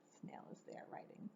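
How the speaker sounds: quick soft whisper. A narrator's reading. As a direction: hurry it in a hush. Whispered aside.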